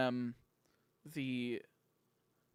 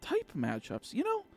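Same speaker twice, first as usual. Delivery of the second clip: mocking; 8 semitones higher than usual. Mocking a gym leader.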